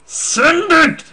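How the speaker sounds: sudden high, loud burst; loud high-pitched outburst; exasperated shout. Real, not acted. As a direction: yell it at the guessers. Urging guessers to get it.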